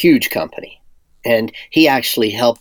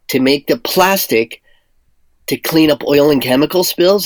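raised, louder incredulous retelling with a laugh; retold disbelief; 5 semitones higher, 4 dB louder than usual. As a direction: replay the moment it clicked, stunned and amused. Retelling his own shocked reaction.

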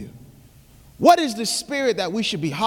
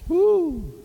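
whoop; 11 semitones higher than usual. Real, not acted. Excited whoop after call.

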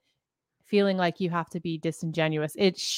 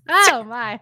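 usual speaking voice

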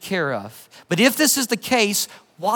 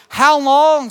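louder, higher cry of appeal; 4 semitones higher, 5 dB louder than usual. Acted. Martyrs calling out to God, crying out. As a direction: call it out as a loud plea. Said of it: "called out in a loud voice".

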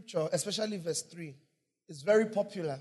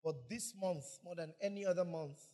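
ordinary talk, nothing marked

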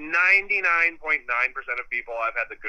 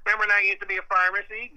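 a hint of a grandpa reminiscing, higher and fast; old man; 9 semitones higher, 3 dB louder, faster than usual. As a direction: lightly play a rambling old man. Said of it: "I'm an old man talking".

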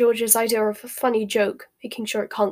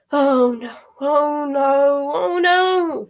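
rising, louder panicked wail; 5 semitones higher, 6 dB louder, much slower than usual. A narrator's reading. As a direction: spiral up into panic. Hermione panics.

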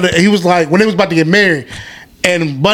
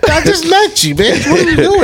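mock outrage; 10 semitones higher than usual.

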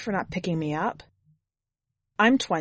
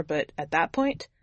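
ordinary delivery, nothing marked

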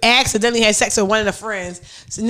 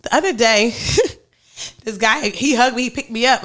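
ordinary talk

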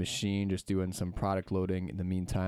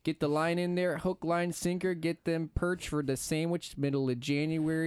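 put-on ice-fishing uncle in a thick Minnesota accent; 8 semitones higher than usual. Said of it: "I'm still working on my Minnesota accent".

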